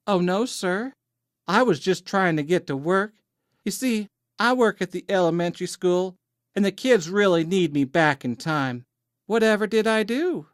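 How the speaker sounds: high, wide-eyed Southern belle; as innocent as possible. A narrator's reading. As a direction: lay on the innocence in a high, sweet voice. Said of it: "said innocently as she could".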